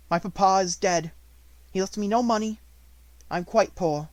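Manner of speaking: put-on a grieving girl holding her ground; steady young Sarah. A narrator's reading. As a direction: refuse calmly and proudly. Sarah answers.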